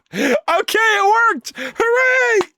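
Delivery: very high, loud triumphant squeal; real delighted cheer; excited cheer. Real, not acted. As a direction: celebrate the win. Animation finally works, celebrating.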